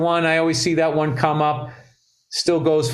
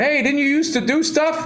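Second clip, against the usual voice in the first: people asking; 10 semitones higher than usual. Retold or imitated. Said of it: "And people going".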